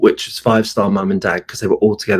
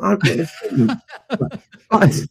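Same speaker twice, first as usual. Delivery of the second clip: slow, much higher, laughing through the words; 10 semitones higher, much slower than usual. Retold or imitated. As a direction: giggle it out slowly. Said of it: "I was like".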